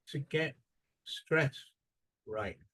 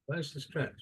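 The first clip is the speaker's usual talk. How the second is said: usual speaking voice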